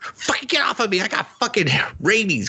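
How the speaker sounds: high squeaky pretend cat voice; voiced cat. Cat snarling at the girl, high mock voice.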